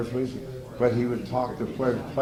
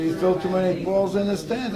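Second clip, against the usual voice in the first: higher-pitched mock scolding; acted complaint; 8 semitones higher, 3 dB louder than usual. Coach complaining to batting practice pitcher, acted complaint. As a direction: protest as a fed-up coach would.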